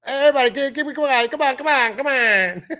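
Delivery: high, loud imitation of a hype man; hammed-up hype man hushing everyone; mocking hype man. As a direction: plead with the crowd in mockery. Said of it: "You saw his hype man".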